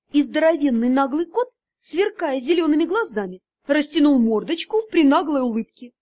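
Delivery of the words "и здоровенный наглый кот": ordinary delivery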